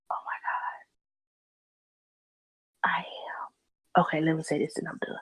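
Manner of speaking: sudden hushed whisper, as if caught ranting. Real, not acted. To herself after ranting about ranting, a hurried whisper. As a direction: drop to a quick breathy whisper and rush through it.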